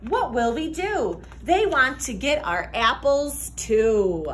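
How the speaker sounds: usual speaking voice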